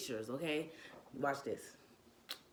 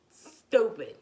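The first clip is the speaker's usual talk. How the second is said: loud scoff; 5 semitones higher, 10 dB louder than usual. Real, not acted. Repeats it louder, mocking.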